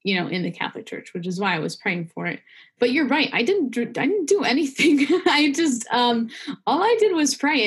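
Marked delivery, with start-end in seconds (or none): 2.8-7.6 high, amused self-deprecation with a laugh breaking in; laughing protest. Real, not acted. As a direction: protest lightly through a laugh, pitched up.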